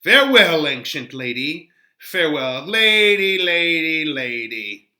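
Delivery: cheeky drawn-out send-off; mocking farewell. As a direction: taunt her as you leave. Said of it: "Mercutio is being a little rough on the nurse".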